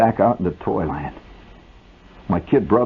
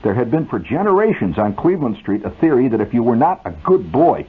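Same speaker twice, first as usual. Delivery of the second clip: usual speaking voice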